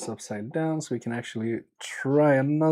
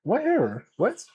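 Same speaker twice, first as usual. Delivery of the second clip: What error? pitched high with a falling end; exasperated disbelief at the machine; baffled; 9 semitones higher than usual. Real, not acted. Host baffled by the soldering station's error message.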